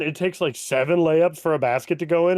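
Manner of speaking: ordinary talk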